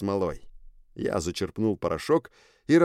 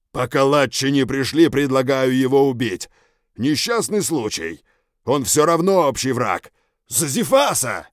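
creature leader voice; 4 semitones higher, 6 dB louder than usual. Acted. Leader proposes killing him.

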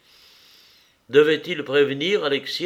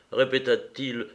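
plain reading voice

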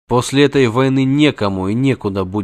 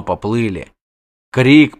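usual speaking voice

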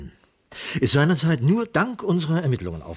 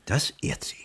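ironic whisper; 5 dB quieter than usual. Acted. Muttered ironic aside.

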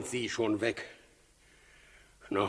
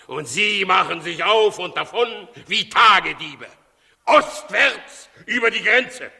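higher, louder furious tirade; furious rant; 6 semitones higher, 4 dB louder than usual. Acted. Herodes raging at the servant over the fled magi, furious. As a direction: spit out the betrayal in rage.

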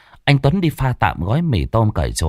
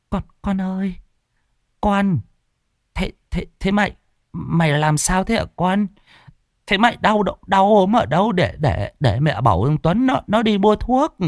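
put-on worried old mother; 10 semitones higher than usual. A narrator's reading. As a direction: coax the sick girl gently. Mother asks sick daughter-in-law. (giọng bà mẹ cao, lo lắng ấp úng)

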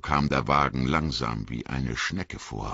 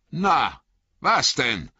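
much higher, louder call; 11 semitones higher, 4 dB louder, slower than usual. Acted. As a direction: call out sharply into the fog. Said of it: "rief der Bauer Margot".

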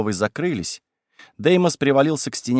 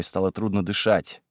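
ordinary delivery

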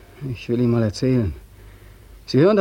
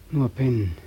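slow and softer, drifting off; dreamy, softer; 2 semitones lower, 7 dB quieter, slower than usual. Acted. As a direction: murmur it like half asleep. Longing for sleep.

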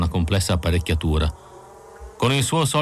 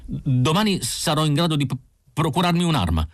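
higher, eager simple-minded man; Isidor, eager; 8 semitones higher than usual. A narrator's reading. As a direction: pipe up eagerly, stumbling a little. Isidor's voice in the story. (Isidor che balbetta, impaziente e con voce più acuta)

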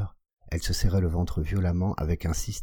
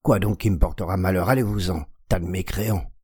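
furious shout; 2 semitones higher, 3 dB louder than usual. A narrator's reading.

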